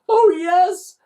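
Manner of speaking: Ned exclaiming back. Acted. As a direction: call it out with certainty. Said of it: "exclaimed Ned".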